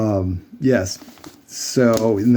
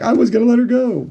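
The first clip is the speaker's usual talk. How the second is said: mocking mother witch; 13 semitones higher, 3 dB louder than usual. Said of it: "she's like".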